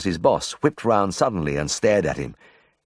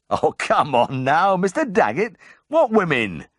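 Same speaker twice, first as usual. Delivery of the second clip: incredulous; 11 semitones higher, slower than usual. Acted. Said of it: "I laughed incredulously".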